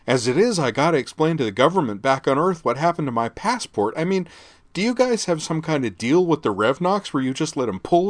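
put-on loud, high, whiny rich-girl rant; agitated princess. A narrator's reading. Spoiled tourist girl ranting at the Lancers, loud and high. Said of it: "agitated princess".

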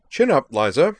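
high, loud, chirpy send-off; cheerful. A narrator's reading. Sky turning back at the doorway, cheerful. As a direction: toss it out brightly, teasing. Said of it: "he said cheerfully".

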